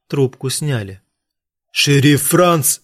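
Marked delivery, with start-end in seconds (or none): 1.7-2.8 slow, loud call down the phone; shouting. A narrator's reading. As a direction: shout the name out. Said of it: "прокричал Боул".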